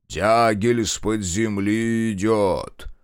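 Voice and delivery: slow, drawn-out ritual chant; trained orator voice. A narrator's reading. Minkult leads the ritual, stately intoning. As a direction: intone it slowly like a ceremony leader. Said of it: "заговорил поставленным голосом".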